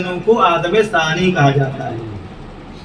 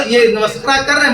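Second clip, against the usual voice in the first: usual speaking voice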